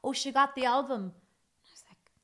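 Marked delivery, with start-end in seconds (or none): 0.0-1.0 breezy offhand news; casual friend. Retold or imitated. Friend Margot casually passing on news, breezy. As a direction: toss it off lightly. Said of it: "And she says".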